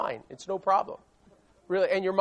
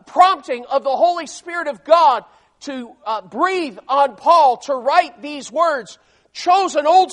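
loud, raised preaching voice; forceful preaching; 6 semitones higher, 9 dB louder than usual. Real, not acted. Preacher explains the servant word to congregation, forceful preaching. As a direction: drive each phrase home to the congregation.

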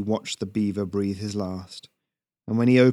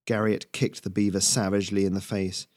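ordinary delivery, nothing marked

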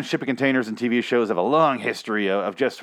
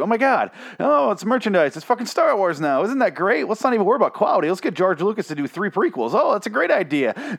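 mock excitement; 9 semitones higher than usual. Sarcastic gushing about merchandise.

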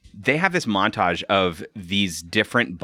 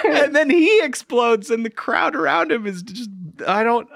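laughing while talking; 13 semitones higher than usual. Real, not acted. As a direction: talk through the giggles. Still laughing after the joke.

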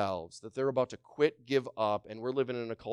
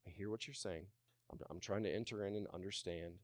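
much softer, calm and tender; gentle reply; 4 semitones lower, 13 dB quieter than usual. Retold or imitated. Gentle friend answering someone picking a fight, much softer and calm. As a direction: soothe an angry accuser. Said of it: "this person's response was always like".

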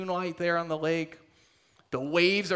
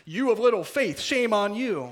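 "You of little faith" higher and louder, a mock scold; hammed-up scolding Jesus, higher and louder; 5 semitones higher, 5 dB louder than usual. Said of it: "The scolding".

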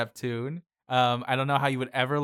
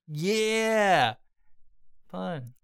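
delighted pun spotting; 7 semitones higher, 4 dB louder than usual. Retold or imitated. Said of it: "So I was like".

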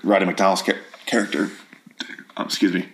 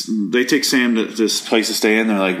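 ordinary delivery

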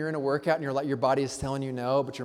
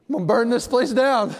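loud, higher, hammed-up fury; mock rage; 7 semitones higher, 10 dB louder than usual. To the congregation, joking churchgoer rage, loud and hammed-up. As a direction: play it big, half-joking anger.

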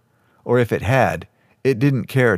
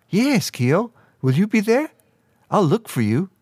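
high-pitched, lit-up eagerness; delighted; 9 semitones higher than usual. A narrator's reading. Girl welcomes friend to the banquet, high and delighted. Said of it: "Bree's eyes suddenly lit up".

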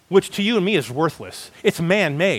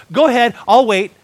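louder, higher mock invitation; Paul issuing a challenge; 5 semitones higher, 6 dB louder, faster than usual. Preacher picturing Paul awaiting an answer, lightly acted challenge. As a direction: call their bluff, raised voice. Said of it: "I can kinda picture Paul going".

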